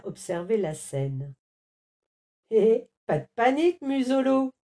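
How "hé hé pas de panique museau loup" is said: quick, teasing, louder lemur voice; lemur teasing the scared wolf; mocking. A narrator's reading. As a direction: poke fun at him. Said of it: "se moqua-t-il".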